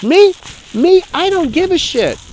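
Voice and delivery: high-pitched, worked-up defiance; defiant rant. Real, not acted. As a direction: burst out high, refusing to care. Worked-up rant about honesty.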